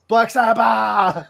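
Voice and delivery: metal scream. Shown as a demonstration. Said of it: "it's like you're screaming".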